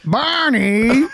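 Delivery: high loud cartoon call; cartoon wife call. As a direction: yell for Barney, cartoon style. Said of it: "we're fucking good at voices".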